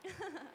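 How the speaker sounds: short, soft little laugh; acted laugh. [name] laughing at her match's question, small acted laugh. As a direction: give a small polite story laugh. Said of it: "I laughed".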